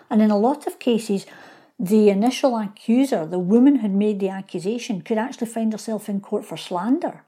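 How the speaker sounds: ordinary talk, nothing marked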